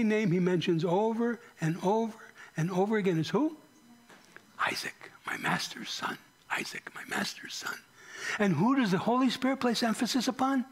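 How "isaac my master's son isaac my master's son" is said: soft, reverent whisper, repeated; whispered quote. Retold or imitated. Preacher quoting the servant to the congregation, whispered. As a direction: breathe the servant's words like a secret. Servant's words voiced, hushed.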